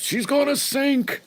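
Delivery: high, squeaky mock alarm; hammed-up falsetto shriek; mock panic. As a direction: squeal it like a tiny boat in peril. Mock panic about tiny boat.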